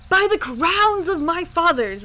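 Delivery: a loud, high royal roar. A narrator's reading. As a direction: roar it out in fury. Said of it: "roared the king".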